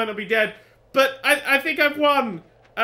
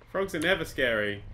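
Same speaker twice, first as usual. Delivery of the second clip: ordinary talk, nothing marked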